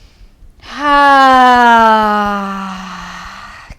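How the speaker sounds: long loud audible exhale; big releasing sigh. Shown as a demonstration. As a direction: let it all out in one big sigh. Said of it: "big sigh".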